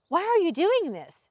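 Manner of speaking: high-pitched, insistent question; pressing. A narrator's reading. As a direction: press hard for an answer. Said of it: "she pressed".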